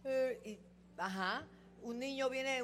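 normal talking voice

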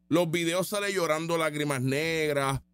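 normal talking voice

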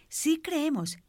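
high, emphatic cry at normal volume; mock full-lung cry. Believers profess faith in Jesus, high mock cry. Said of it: "gritamos a todo pulmón".

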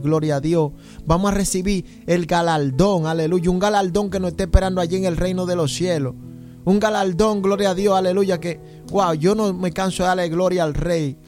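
ordinary delivery

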